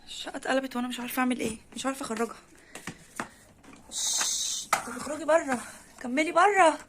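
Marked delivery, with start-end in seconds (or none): 3.9-4.7 shushing. Real, not acted. Hushing the mother cat. 4.7-6.8 rising to a loud call, louder on the second order; urging call. Real, not acted. Owner loudly urging the cat out of the flat, calling.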